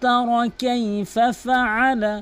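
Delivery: slow, melodic tajweed recitation; Quran recitation. Acted. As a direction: chant it slowly, holding the long vowels. (সুরেলা ধীর কোরআন তিলাওয়াত)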